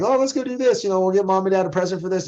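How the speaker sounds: high-pitched put-on sister voice; imitated sister. Lecturer quoting a friend's sister making plans, high imitation. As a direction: pitch it way up to mimic the sister chatting.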